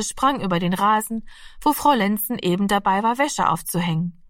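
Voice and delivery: plain reading voice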